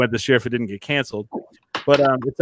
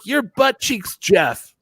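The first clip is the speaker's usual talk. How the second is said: lightly voiced little boy, higher pitch; little boy's verdict; 8 semitones higher, much slower than usual. Boy naming Marty on the spot, lightly imitated. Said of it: "He's like".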